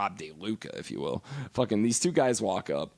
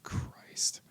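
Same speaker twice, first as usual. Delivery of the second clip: whispered, exasperated inner groan; 7 dB quieter than usual. Retold or imitated. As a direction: sigh it under your breath. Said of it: "And I just thought".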